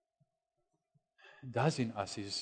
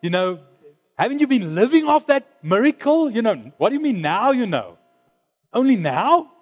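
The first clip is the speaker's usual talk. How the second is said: much higher and louder, incredulous; mock exasperated challenge; 8 semitones higher, 7 dB louder than usual. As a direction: hammer her with disbelieving questions.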